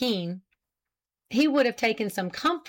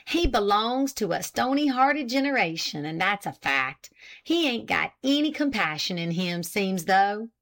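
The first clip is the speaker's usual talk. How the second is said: usual speaking voice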